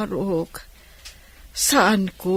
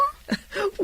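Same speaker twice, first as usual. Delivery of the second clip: laughing; 7 dB quieter than usual. Acted. Laughs at the goat question.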